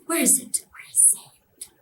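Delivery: menacing whisper fading to breath; whisper, then barely breathe the repeat; whispered taunt. Retold or imitated. Devil asking where her power is, whispered taunt.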